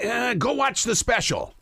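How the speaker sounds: high, slow heckler voice, WATCH leaned on; mock heckler. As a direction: shout it up from the back row, drawn out. Voicing a heckler in the crowd.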